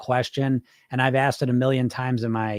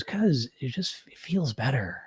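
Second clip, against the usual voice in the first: much softer, a hushed inner voice; little quiet voice; 8 dB quieter, slower than usual. Pretend. Coach voicing the little inner voice, hushed. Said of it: "that little voice goes".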